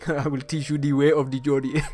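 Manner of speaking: put-on playful African-accented meme voice; hammed-up mock African accent. Meme character voice with foreign accent.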